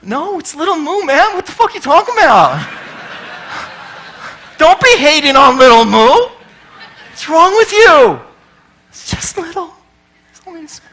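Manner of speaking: high, loud mock outrage defending Little Moo; mock indignation. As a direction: snap back at the friend in hammed-up outrage. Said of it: "you're like".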